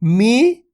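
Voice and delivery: मी held long, rising at the end; teacher's sustained मी; drawn-out long vowel. Shown as a demonstration. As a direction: stretch the ee in मी. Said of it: "इसको खींच के पढ़ेंगे".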